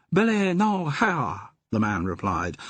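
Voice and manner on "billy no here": high put-on pidgin English, adding "y" to words; a cheeky opium-den keeper laying on a fake pidgin accent; mock pidgin accent. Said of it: "Do you have to talk like that?".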